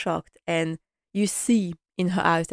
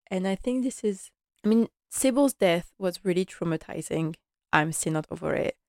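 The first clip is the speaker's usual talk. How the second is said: normal talking voice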